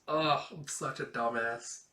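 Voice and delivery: usual speaking voice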